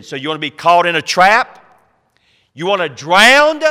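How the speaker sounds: loud, rising rhetorical questions; preacher's shouted warning; challenging shout. Real, not acted. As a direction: throw it at the congregation like a dare.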